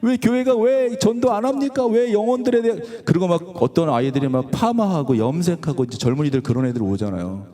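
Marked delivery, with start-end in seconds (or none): 0.0-2.8 retold protesting prayer, pitched high; pleading prayer. Pastor asking the Lord why the church is like this, high retold plea. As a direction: plead upward with insistent whys. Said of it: "또, 또 기도했어요".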